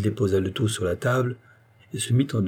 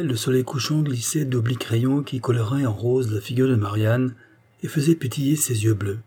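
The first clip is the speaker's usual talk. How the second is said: ordinary delivery, nothing marked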